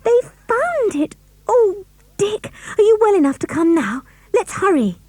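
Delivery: high, eager and breathless; bubbling over with joy; girl thrilled, urging her brother on; excited. A narrator's reading. Anne thrilled by the news.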